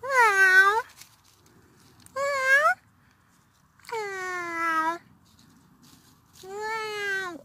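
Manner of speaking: high imitated meows; meowing at the cat. Owner meowing at the cat, high and playful. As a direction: meow at the cat like a kitten.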